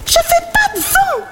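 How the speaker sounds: very high squeaky bat, shouting; tiny high-pitched voice. Acted. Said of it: "de sa petite voix aiguë".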